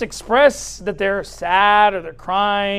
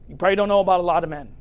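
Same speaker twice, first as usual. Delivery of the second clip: ordinary talk, nothing marked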